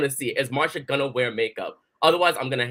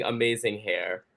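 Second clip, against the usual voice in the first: ordinary talk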